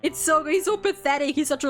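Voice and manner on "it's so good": ordinary delivery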